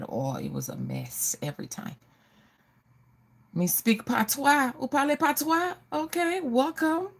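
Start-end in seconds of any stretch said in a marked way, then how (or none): 3.5-7.1 playful put-on Jamaican patois, high-pitched. Vlogger playfully greeting her Jamaican people, high mock patois accent. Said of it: "I love my Jamaican people".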